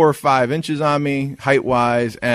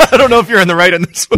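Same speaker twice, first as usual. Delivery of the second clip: fast, laughing tease; 12 semitones higher, 6 dB louder, faster than usual. Real, not acted. Laughing teasing.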